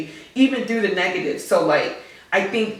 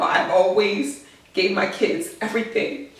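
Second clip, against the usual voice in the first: normal talking voice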